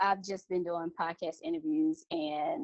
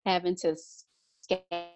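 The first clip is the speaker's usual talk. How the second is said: bad connection; much slower than usual. Real, not acted. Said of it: "Your voice cut out a little".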